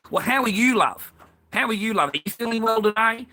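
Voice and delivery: high, sing-song fake concern; sugary mocking imitation of the cashier; mock sweet cashier. Ranting man mimics the shop cashier asking how he is, sugary mock.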